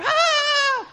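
high-pitched acted scream; mock scream. Person prayed over at church, high acted scream. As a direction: let out a high, sudden cry.

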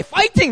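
high, cheered "fighting" with an English F. Retold or imitated. Preacher imagines saying it to Koreans, high "fighting" with F. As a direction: call out "fighting" high, starting on F. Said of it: "If you say".

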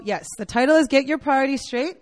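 normal talking voice

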